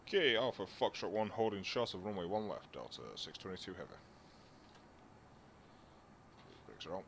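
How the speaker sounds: thin, distant radio voice; air traffic control radio. Real, not acted. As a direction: speak as if over a cockpit radio. Pilot radio readback.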